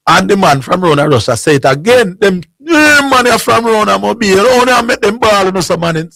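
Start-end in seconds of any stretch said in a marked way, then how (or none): 2.2-6.1 mock taunt. Russia man taunting again.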